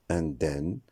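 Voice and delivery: indemne ending in N only, in-dènn; wrong pronunciation. Shown as a demonstration. Teacher showing a mistake like automne, indemne as in-dènn. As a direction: drop the M from indemne. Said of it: "Ce mot ne se prononcera pas".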